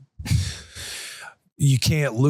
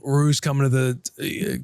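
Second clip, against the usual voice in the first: ordinary talk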